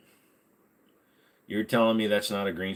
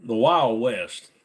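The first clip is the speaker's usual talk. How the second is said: slow drawl; 5 dB louder, much slower than usual. Shown as a demonstration. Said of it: "slower drawl".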